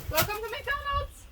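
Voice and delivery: high chirpy fast-food greeting; drive-through cashier voice. Pretend. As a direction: greet them like a cheery cashier. Playing drive-through server to horses.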